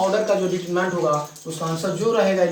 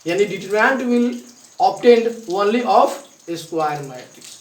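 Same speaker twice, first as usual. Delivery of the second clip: slow emphatic rule; 3 semitones higher, 7 dB louder, much slower than usual. Shown as a demonstration. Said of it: "teacher stressing the rule slowly".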